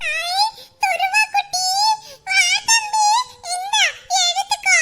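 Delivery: put-on very high, slow baby-talk coo; cooing to child. Aunt cooing to little Dhruva while offering him food. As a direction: sweet-talk the little boy.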